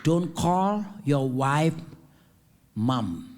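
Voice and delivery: slow emphatic warning. Acted.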